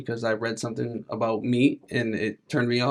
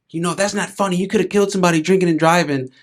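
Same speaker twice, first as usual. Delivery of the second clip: higher-pitched mock telling-off; retold scolding; 6 semitones higher, 6 dB louder, faster than usual. Nurse telling him off for drinking and driving, scolding. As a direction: relay the nurse's reprimand in a raised pitch.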